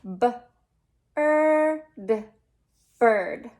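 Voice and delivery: "B-er-d" split into sounds, then "bird" said whole; teacher sounding out bird; sounding out a word. Shown as a demonstration. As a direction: stretch out B, er, d before "bird". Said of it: "Our next word is bird".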